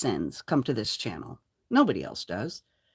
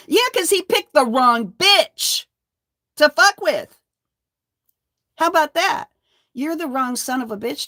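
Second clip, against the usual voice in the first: triumphant taunt; 9 semitones higher, 6 dB louder than usual. Real, not acted. Taunting the man who threatened to sue, triumphant and loud. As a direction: crow it at him in triumph.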